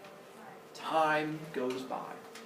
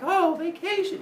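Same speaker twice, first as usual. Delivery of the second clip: put-on high, louder kid delight; excited children; 5 dB louder than usual. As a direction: squeal at news of a holiday. Children thrilled at vacation.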